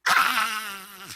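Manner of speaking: loud mock child's wail; sudden loud "aah" wail; imitated crying scream. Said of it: "이렇게 울었어요".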